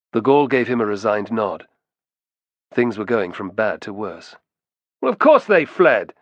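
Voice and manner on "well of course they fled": loud, higher, contemptuous jeer; vicious. A narrator's reading. One-eyed man scorns the two accused friends, vicious. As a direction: sneer it out with raised voice. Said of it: "said the one-eyed man viciously".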